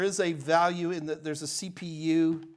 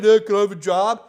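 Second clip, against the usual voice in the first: loud mock job-seeker's request; mock applicant; 11 dB louder than usual. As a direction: imitate a pushy applicant, loudly. Mimics a clueless job applicant.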